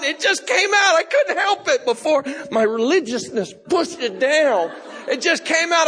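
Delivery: high, loud burst of confession; worked-up high-pitched storytelling yell; excited outburst. Retold or imitated. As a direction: blurt it out at the top of your voice. Acting out his uncontrollable outburst.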